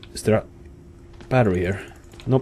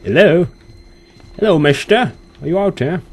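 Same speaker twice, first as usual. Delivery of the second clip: high-pitched searching hello; raised, lilting call; 7 semitones higher, 5 dB louder than usual. Pretend. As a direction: call out lightly for someone hidden. Calls to hidden game villain.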